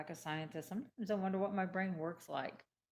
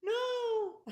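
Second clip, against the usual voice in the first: much higher, loud disbelieving cry; shocked refusal; 7 dB louder than usual. Retold or imitated. Refusing the doctor's antidepressants, shocked. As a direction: recoil in alarm. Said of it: "And I went".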